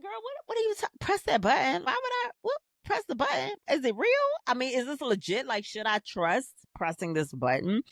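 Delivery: hammed-up shock, pitch flying up; incredulous disbelief. Retelling her stunned reply to her friend, high incredulous squeal.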